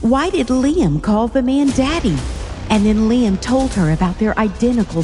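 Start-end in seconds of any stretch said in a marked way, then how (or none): none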